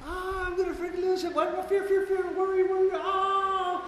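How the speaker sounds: high, shouted fake freak-out; hammed-up frantic worrying; mock panic. Newcomer panicking in fear.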